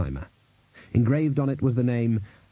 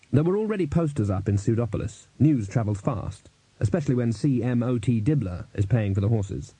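usual speaking voice

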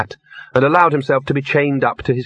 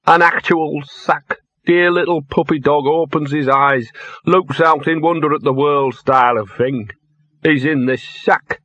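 slow, higher, aggrieved dog voice; self-pitying drunk dog; 5 semitones higher than usual. A narrator's reading. Drunk dog tells of his start in life, maudlin. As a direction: draw out the hard-luck tale in character.

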